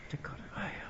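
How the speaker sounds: whispered exasperation; hushed aside under the breath; hidden sigh. Retold or imitated. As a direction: breathe out the thought you hide. Inner thought behind polite face.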